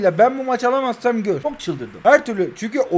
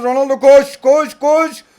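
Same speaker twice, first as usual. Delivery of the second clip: loud, higher urging shouts, driving the striker on; excited cheering; 4 semitones higher, 6 dB louder than usual. Real, not acted. Gamer yelling at his striker on a breakaway, loud and eager. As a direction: yell him toward goal, building hope.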